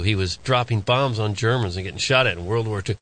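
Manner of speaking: usual speaking voice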